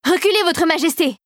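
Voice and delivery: high-pitched warning cry; urgent call-out. A narrator's reading. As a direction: call out to protect the queen. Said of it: "lança un soldat".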